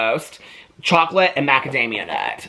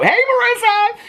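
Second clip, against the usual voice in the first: high sing-song hey to a friend; greeting a friend who watches the vlogs; cheery call-out; 4 dB louder than usual. Real, not acted. Calling out to viewer.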